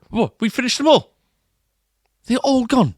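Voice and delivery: high, loud disbelief; can't believe they're all gone; shocked. Real, not acted. Shocked biscuits are finished.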